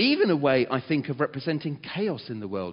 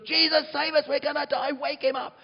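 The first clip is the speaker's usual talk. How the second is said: panicked screaming; 13 semitones higher than usual. Retold or imitated. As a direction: scream for help like a terrified child. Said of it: "screaming like little children".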